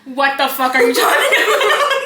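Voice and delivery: words breaking into real laughter; slow, loud and laughing at the absurdity; laughing while asking what that means; laughing disbelief. Real, not acted. Laughing at the photo.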